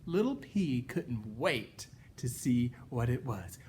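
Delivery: ordinary delivery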